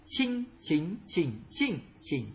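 one syllable in each Mandarin tone, "Chinh, chính, chỉnh, chỉnh, chỉnh"; tone drill. Shown as a demonstration. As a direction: recite "Chinh, chính, chỉnh, chỉnh, chỉnh" changing only the pitch contour. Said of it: "phần luyện tập về thanh điệu".

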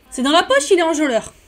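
plus aigu et plus fort, triomphant; triomphe joyeux. Real, not acted. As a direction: savoure la victoire à voix haute. Triomphe, Sim séduite. (triomphe ravi, plus haut et plus fort)

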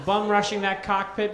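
ordinary talk

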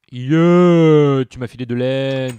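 excited cheer. Real, not acted. Player cheering loudly when the sheep drops wool. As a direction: whoop like you just won.

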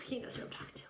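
quick whispered aside. Real, not acted. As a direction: rush it out under your breath. Aside after loving boyfriend.

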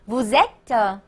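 "vous êtes" joined slowly as "vu-zèt"; correct liaison. Shown as a demonstration. Teacher repeating the linked form for students, "vous êtes" with z liaison. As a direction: carry a z from "vous" into "êtes", unhurried. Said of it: "应该读成".

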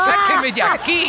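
high, slow mock plea; half-joking stage plea; pleading look. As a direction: beg to be rescued, hammed-up. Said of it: "como diciendo".

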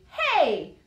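loud rough holler. Acted. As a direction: holler at the small truck.

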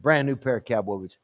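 low, soft, matter-of-fact reply; quiet confirmation. Acted. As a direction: confirm the offer under your voice. Pastor confirms the bribe, quietly.

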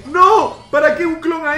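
loud, high shout of disbelief; alarmed protest. Real, not acted. Player scolding teammate over the clone, alarmed yell.

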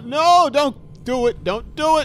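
high, loud desperate no, don't do it; panicked yelling. Real, not acted. Gamer yelling at the chaser closing in, panicked. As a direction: plead at the screen in panic.

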